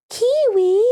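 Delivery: high sing-song call to the cat; calling a pet. Real, not acted. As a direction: coo the cat's name up high.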